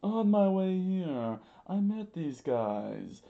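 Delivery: high, slow theatrical wail; swooping, stretched-out panicked voice; freaking out. Acted. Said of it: "Mister Two freaked out".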